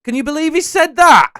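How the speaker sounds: high, booming title reveal; game-show announcer shout. Pretend. Host reveals the quiz game's name to his contestant, announcer shout.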